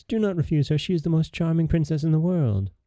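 high, pleading queen; distressed pleading. A narrator's reading. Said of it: "said the queen in great distress".

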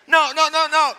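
loud, high, amused correction; laughing protest. Real, not acted. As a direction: laugh while hurriedly calling them off. Playfully correcting the congregation's response.

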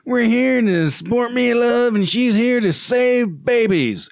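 high, slow preachy mock announcer voice; hammed-up imitation of the speaker at the rally; mocking Spencer Cox. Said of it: "Spencer Cox like".

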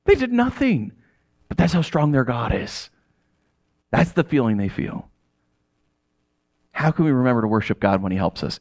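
ordinary talk, nothing marked

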